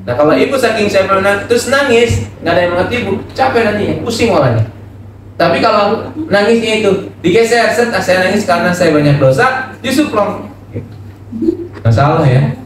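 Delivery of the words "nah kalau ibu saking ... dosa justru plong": rising urgency. Acted. Preacher builds, high and urgent.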